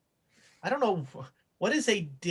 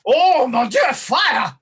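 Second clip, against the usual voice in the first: put-on loud, higher French-accented shout; hammed-up French chef in a panic; panicking French chef; 5 semitones higher, 7 dB louder, slower than usual. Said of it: "he's freaking out".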